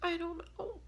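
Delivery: tearful breathy whisper through tears; whispering while still crying. Real, not acted. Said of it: "I just can't stop crying".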